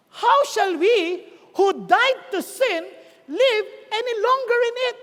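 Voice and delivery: very slow rhetorical challenge in chunks; emphatic scripture reading. Acted. Preacher puts Paul's question to congregation, emphatic. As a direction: declaim the question slowly, phrase by phrase. Reads Romans aloud.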